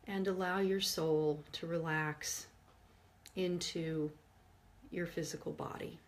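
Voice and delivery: soft meditation voice with long pauses; guided meditation. Real, not acted. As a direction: guide listeners gently inward, hushed and unhurried. Leads a forgiveness meditation.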